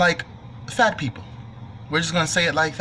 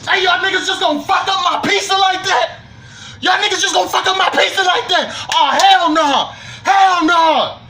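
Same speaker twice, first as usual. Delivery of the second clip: furious shout; 14 semitones higher, 6 dB louder than usual. Retold or imitated. Furious over ruined pizza.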